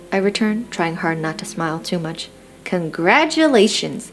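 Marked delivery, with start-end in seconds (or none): none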